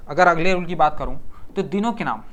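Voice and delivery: ordinary talk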